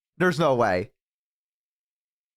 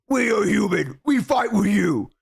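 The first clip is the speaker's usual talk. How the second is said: lightly voiced orc; 3 semitones higher than usual. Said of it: "and go".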